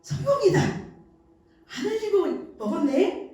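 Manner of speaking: put-on a mother cheering her toddler's success; delighted cheer. Shown as a demonstration. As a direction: cheer the child's first clean spoonful. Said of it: "그때 엄마가".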